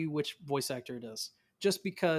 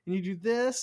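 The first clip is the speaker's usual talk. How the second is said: put-on higher-pitched voice of a little girl showing how; child explaining, pitched up; young daughter instructing; 5 semitones higher than usual. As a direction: imitate a daughter patiently teaching Papa. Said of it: "she'll go through all of it".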